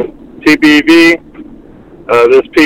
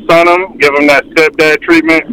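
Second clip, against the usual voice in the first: normal talking voice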